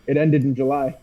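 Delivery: ordinary delivery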